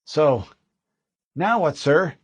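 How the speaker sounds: lightly voiced private asking orders; young Marine. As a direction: private asking his corporal what next. Walker finally spoke.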